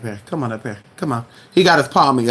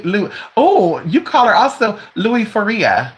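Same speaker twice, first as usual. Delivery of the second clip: ordinary delivery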